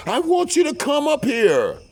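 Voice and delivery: very high, loud mock squeal; insistent plea. Mistress's plea repeated, intensified.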